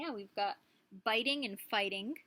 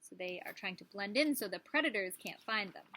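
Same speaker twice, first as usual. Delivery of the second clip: ordinary talk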